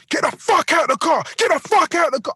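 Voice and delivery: high, loud furious demand; high-pitched angry shout, words punched out; acted screaming. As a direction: re-enact yelling at someone in a car. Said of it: "I'm screaming".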